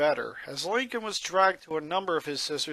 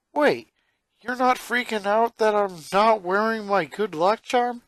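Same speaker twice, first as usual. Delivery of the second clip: put-on higher, louder boy voice in disbelief; can't believe what he hears; surprised boy; 7 semitones higher, 5 dB louder than usual. Lincoln shocked Lisa stopped fearing his luck, surprised and louder.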